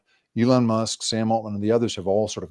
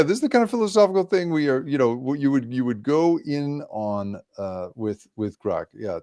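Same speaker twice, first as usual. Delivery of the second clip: ordinary talk, nothing marked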